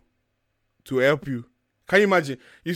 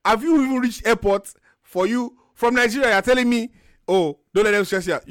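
higher, louder, mock disbelief; incredulous retort; 6 semitones higher, 3 dB louder than usual. To a Nigerian commenter giving advice, incredulous retort. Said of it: "I'm like".

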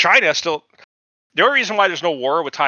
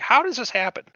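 higher, puzzled mock question; baffled; 9 semitones higher than usual. Podcaster imitating his own confusion, higher and baffled. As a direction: ask it as if truly stumped. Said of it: "I was like".

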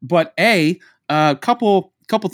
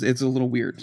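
normal talking voice

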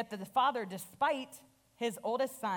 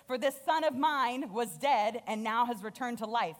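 louder, higher, calling for a feast; excited father; 4 semitones higher, 5 dB louder than usual. Acted. Father calling servants to celebrate, loud and overjoyed. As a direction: throw the party open, full of joy. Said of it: "So he's like".

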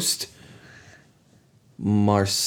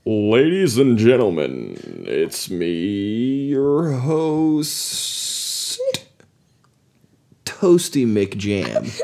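announcer voice; 4 dB quieter, much slower than usual. Acted. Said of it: "you gotta do it like an announcer".

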